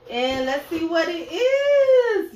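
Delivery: high, slow whine; whiny high voice. Pretend.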